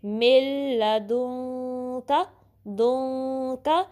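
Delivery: slow मिल लदुन का, long nasal hum in दुन before का; ikhfa recitation. Shown as a demonstration. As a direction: merge मिल into ल without nose, then nasalise दुन. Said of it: "नून की वो नाक में जाएगी".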